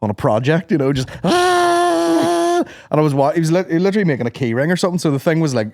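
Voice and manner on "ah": long high mock groan, slow; drawn-out groan. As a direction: stretch out a bored ah. Retold groan at sanding.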